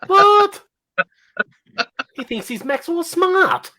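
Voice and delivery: high-pitched disbelief; incredulous. Real, not acted. Host reacting to the guest's get-up, incredulous. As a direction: squeak it out in amused disbelief.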